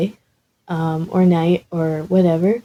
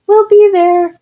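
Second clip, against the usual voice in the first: calling out; 14 semitones higher, 6 dB louder than usual. Acted. Izuku calling back.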